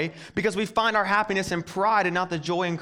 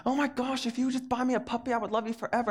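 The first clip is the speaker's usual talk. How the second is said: higher, softer mock girlfriend voice; imitating girlfriend; 6 semitones higher, 4 dB quieter than usual. Girlfriend asking him to buy her a puppy, mock high voice. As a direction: play the girlfriend begging for a puppy. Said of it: "she made a comment to me like".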